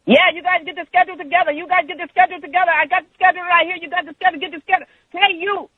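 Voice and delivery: high-pitched, rapid screechy mimicry of a coworker; mock screaming, words tumbling out; imitated hollering. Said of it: "she's just hollering and screaming at me".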